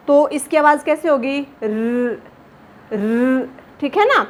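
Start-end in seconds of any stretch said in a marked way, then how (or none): 1.6-3.5 र with a slight vibration in the voice; trilled र. Shown as a demonstration. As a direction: let the र tremble a little. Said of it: "आपकी आवाज़ में थोड़ी सी कंपन सी आएगी". (आवाज़ में हल्की कंपन के साथ र)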